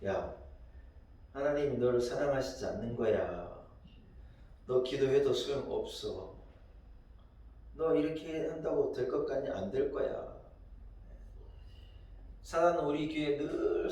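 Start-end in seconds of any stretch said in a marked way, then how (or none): 0.1-10.3 low, soft, sly tempter's murmur; Satan whispering. Acted. Evil Satan whispering in your ear when prayers go unanswered, low and soft. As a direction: hiss doubt softly into their ear, lower and softer. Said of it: "악한 사단이 내 귀에 속삭이는 거예요".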